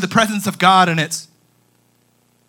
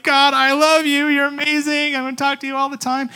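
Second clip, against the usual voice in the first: mock teary-eyed; 8 semitones higher, 4 dB louder than usual. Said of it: "We're like, teary-eyed".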